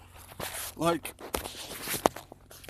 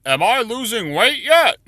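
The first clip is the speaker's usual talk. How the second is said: much higher, louder anxious pleading; hopeful patient asking, much higher; anxious fat man; 11 semitones higher, 8 dB louder than usual. Acted. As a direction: plead it high and nervous like the burning patient. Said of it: "And he says".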